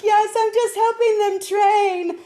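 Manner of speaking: high, slow sing-song brightness; chirpy cover-up. Retold or imitated. Girl reassuring worried drivers in a high, slow chirp. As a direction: pretend all is fine. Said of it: "I'm like".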